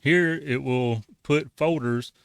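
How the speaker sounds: ordinary delivery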